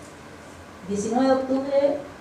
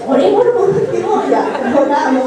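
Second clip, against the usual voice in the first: much higher and louder exclamation; excited; 3 semitones higher, 7 dB louder than usual. Real, not acted. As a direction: burst out with it. Recalls finally praying, laughing. (exclamación emocionada)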